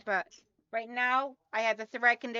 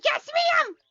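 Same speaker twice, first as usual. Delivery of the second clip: high, loud squealed yes ma'am; eager yes ma'am; 8 dB louder than usual. Retold or imitated. Reading chat from Sandra, squealing eagerly. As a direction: squeal it like a keen fan. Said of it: "Sandra goes".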